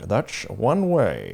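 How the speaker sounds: slow, drawn-out Scottish Sean Connery impression. Friend's church spoof ad, slow Scottish Connery drawl. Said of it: "It was Sean Connery".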